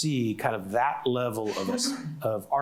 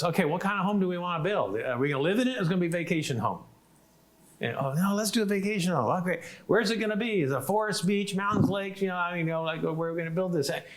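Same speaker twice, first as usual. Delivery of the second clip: upbeat presenter; 7 semitones higher than usual. Retold or imitated. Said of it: "so he goes".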